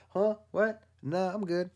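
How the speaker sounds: high surprised huh-what, then a shrug; mock startled reply. Fool shrugging off a warning, high startled squeak. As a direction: play the oblivious fool caught off guard.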